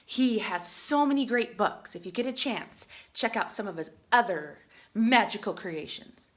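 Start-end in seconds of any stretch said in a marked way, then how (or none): none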